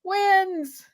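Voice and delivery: high, elated squeal of victory; overjoyed. Real, not acted. Co-host rejoicing for Cillian Murphy, overjoyed. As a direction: savour the win in a high voice. Celebrating the award win.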